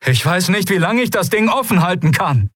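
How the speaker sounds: high, strained warning; urgent call. A narrator's reading. Kai holding the breach open, high strained warning. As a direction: warn while holding on with effort. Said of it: "rief er".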